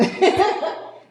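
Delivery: breathy burst of laughter. Real, not acted. Speaker laughing at her own line.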